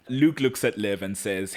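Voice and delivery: ordinary talk, nothing marked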